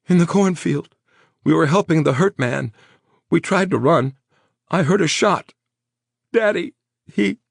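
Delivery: high, loud tearful panic; hysterical. A narrator's reading. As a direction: voice climbing in terror. Traumatized woman recounting shooting.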